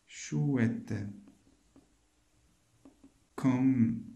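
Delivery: slow, each word set apart by long pauses; spaced-out word-by-word reading. Shown as a demonstration. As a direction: read it out one word at a time, slowly. Teacher reads phrase word by word.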